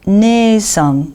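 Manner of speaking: Ne held out before san; long E in Ne-san. Shown as a demonstration. Teacher models an exception, long E in Ne-san. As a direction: stretch the E of Ne-san. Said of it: "qui se prononce E".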